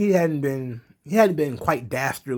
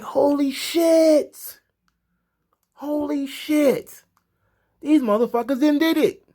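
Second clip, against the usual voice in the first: much higher, slow, drawn-out excited cry; thrilled disbelief; 12 semitones higher, slower than usual. Retold or imitated. Fan reliving the surprise return, high excited cry. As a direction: let it rise in giddy shock. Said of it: "I was like".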